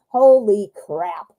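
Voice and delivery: ordinary talk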